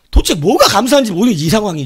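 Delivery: loud venting that tails off; very annoyed. Retold or imitated. Believer venting to God about being told thanks, loud irritation. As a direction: imitate an irritated believer grumbling. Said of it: "짜증이 엄청 나고 있는데".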